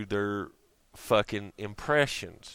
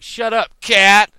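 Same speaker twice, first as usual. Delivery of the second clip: yelling at cat; 10 semitones higher, 14 dB louder, slower than usual. Retold or imitated. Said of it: "you're like".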